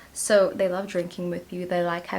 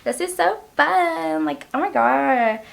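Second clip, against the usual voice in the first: playful glee; 8 semitones higher, 6 dB louder than usual. Retold or imitated. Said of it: "I'm just like".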